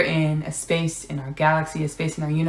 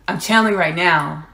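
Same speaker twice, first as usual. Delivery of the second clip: ordinary talk, nothing marked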